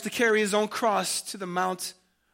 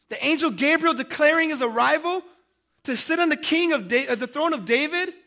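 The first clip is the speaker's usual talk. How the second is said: higher, louder questions rising in disbelief; incredulous wondering; 6 semitones higher, 4 dB louder than usual. Acted. A preacher voices doubters watching the story unfold, incredulous. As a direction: question God in raised, bewildered disbelief. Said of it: "you get the sense of wondering".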